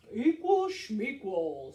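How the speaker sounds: slow, loud bear scoff; big uninterested bear voice; dismissive bear. Acted. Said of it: "said Bear".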